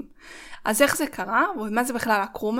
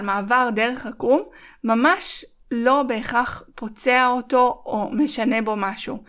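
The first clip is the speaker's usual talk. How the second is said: ordinary delivery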